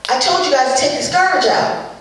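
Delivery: put-on high, calling out to the kids; a mom hollering about the garbage; mock mom yell. Mom yells at the kids, playful mock call. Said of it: "Mom will yell out".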